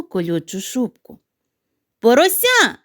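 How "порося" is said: high, loud excited call; shout. Acted. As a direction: call out to her friends, higher and louder. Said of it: "крикнула сніжинка подругам".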